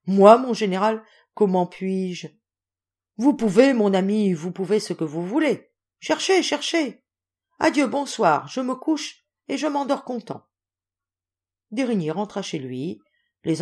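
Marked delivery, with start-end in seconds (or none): 0.0-2.3 louder, startled protest; stupefied. A narrator's reading. D'Érigny to the general, stunned and louder. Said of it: "D'Érigny stupéfait". 3.2-10.0 bluff insistence. A narrator's reading. General insists cheerfully.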